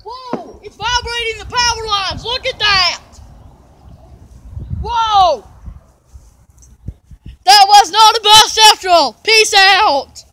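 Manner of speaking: loud excited yelling; excited shouting. Real, not acted. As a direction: shout over the siren. Siren blaring nearby.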